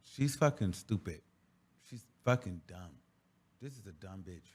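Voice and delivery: low, soft, under-the-breath insults; muttered insults. Real, not acted. Host mutters insults at the clip, under his breath. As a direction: mutter it to yourself in disgust.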